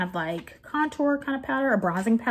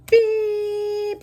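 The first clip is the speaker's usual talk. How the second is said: long drawn-out high beep like a car horn; 11 semitones higher, 3 dB louder than usual. Retold or imitated. Retelling the driver blaring his horn, high drawn-out beep. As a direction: mimic the horn blaring, stretched slow. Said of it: "He's like".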